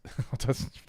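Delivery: soft unvoiced laugh at yourself; breathy laugh. Real, not acted. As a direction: let out a quiet embarrassed laugh. Laughing at own bad accent.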